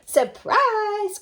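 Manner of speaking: high, loud wolf shout; wolf bursting in on Granny; wolf's cry. Acted. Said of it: "cried the wolf".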